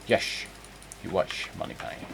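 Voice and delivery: quiet suave spy with slushy s sounds; hushed secret-agent impersonation; Sean Connery. Acted. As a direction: murmur it as a Scottish screen spy. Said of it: "he was also a spy".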